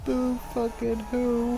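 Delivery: usual speaking voice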